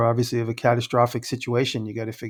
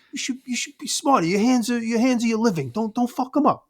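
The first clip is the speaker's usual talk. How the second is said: higher mock Long Island lecture; a buddy scolding a woodworker about his hands; tough-love scolding; 9 semitones higher than usual. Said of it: "trying to give me tough love".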